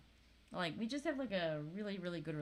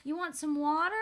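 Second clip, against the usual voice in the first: put-on high, sweet toddler voice; little-kid voice, pitched way up; sweet little child; 9 semitones higher than usual. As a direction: imitate a small child being extra sweet. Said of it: "in the sweetest little face".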